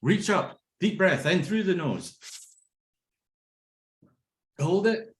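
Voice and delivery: breathing coach cueing each step with held pauses; teacher guiding a breathing exercise; guided breathing. Shown as a demonstration. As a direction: lead the breath in and hold. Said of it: "so let's see".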